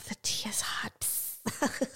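unvoiced gossip whisper. Friend teasing juicy gossip, whispered.

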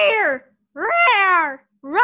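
loud playful dragon roar; huge fiery dragon roar. Pretend. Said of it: "Scary dragon noises".

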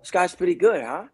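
high, upbeat approving voice; impressed boss. Retold or imitated. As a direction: praise him casually. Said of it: "The creator of the show comes over".

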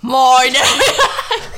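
loud mock English accent; hammed-up English accent with a laugh; Harry Potter. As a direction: belt out a silly English accent. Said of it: "do I have to do the voice".

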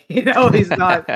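high, loud laugh through the words. Real, not acted. Bursting into laughter mid-sentence.

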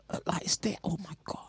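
breathy shocked whisper at the bill. Real, not acted. Whispering in shock at the bill. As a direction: hush it out, stunned.

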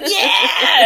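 high whoop through laughter; excited cheer. Retold or imitated. Podcaster voicing viewers cheering the race, laughing cheer. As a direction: cheer as if watching your team race.

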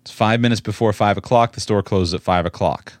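ordinary talk

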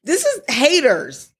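high-pitched, indignant begging; exasperated plea. Real, not acted. Host begs his brother for a moment, exasperated. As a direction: plead for space to enjoy it.

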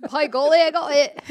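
loud, high, hammed-up British exclamation; mock British accent. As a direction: blurt it like a jolly Brit. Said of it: "I like that British accent".

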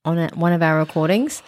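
ordinary talk

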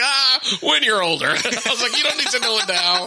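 high, giggly dad brush-off; laughing deflection. Retold or imitated. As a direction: put the kid off while laughing. Laughing dad fends off daughter's demand.

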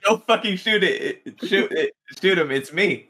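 high, loud, laughing plea; laughing panicked yell. Retold or imitated. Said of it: "I was like".

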